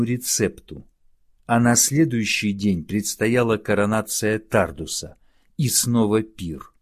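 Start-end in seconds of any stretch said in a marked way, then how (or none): none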